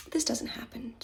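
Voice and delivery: unvoiced, hushed disbelief; whispered disbelief. Doubting Monica's warning in her head, whispered. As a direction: whisper it to yourself. Inner thought, disbelieving aside.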